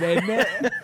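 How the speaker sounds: lightly put-on British innit; mock British accent. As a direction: tack on a cartoon British isn't it. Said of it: "get accent going".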